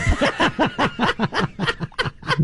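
high, soft helpless laugh. Real, not acted. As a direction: break up laughing. Big laugh after reading.

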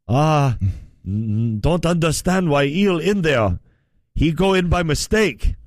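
lightly put-on Chinese accent; a hint of a Chinese accent, halting. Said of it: "It's an accent".

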